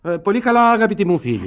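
absent-minded refrain. Acted. Lord Edgar to his wife, dreamy and absent. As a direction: answer on autopilot, lilting up high.

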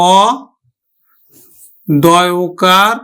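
অ, then দয় ওকার with pauses; spelled out slowly. Shown as a demonstration. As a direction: spell অদম্য piece by piece. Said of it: "তাহলে আমরা উচ্চারণ করবো". (অদম্য ভেঙে বানান করে বলা)

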